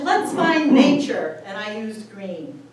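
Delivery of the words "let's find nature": high, loud and slow, cheerful; bright excited self-quote. Retold or imitated. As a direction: announce it gleefully, up high. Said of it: "And I said".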